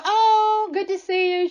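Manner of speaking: high, sing-song greeting in a mother-in-law voice; sweet high mother-in-law voice. Retold or imitated. Retelling the mother-in-law's welcome, in a lightly voiced high greeting. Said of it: "And she says".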